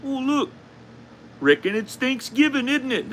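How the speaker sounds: high-pitched caricature of a cheery Canadian; hammed-up Canadian greeting, way up high; mock Canadian accent. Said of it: "Are Canadians like".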